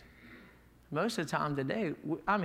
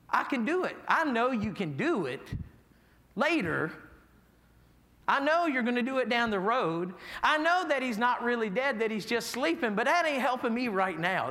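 higher, louder, pressing complaint; impatient grieving plea; 6 semitones higher, 3 dB louder than usual. Retold or imitated. Grieving sister presses Jesus, raised and insistent. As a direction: press him hard, hurt and impatient. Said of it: "kind of the attitude that she came".

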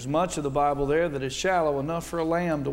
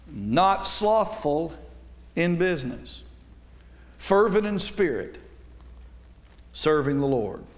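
scripture reading; 2 semitones higher, slower than usual. Acted. Preacher reading Romans twelve eleven slowly to the congregation. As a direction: read the verse slowly and weightily, word by word. Reads the verse aloud.

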